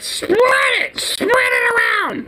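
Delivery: very high, slow, crying shout; mock shrieking order. Worker imitates the lady screaming to spread the mayo, shrill mock wail. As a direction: wail the order at the sandwich maker. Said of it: "and she's like".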